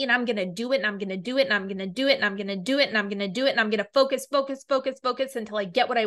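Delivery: very fast, driving run-on repetition; acted resolve, racing without a break; relentless determination. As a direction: rattle it off like an unstoppable mantra. Acts out relentless determination to persist.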